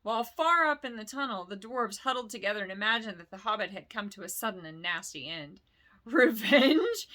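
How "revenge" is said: scornful snort, higher and louder; snorting dragon. Acted. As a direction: spit the word back in scorn. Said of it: "he snorted".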